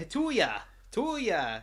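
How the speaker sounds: mock Canadian. Mock Canadian Santa greeting.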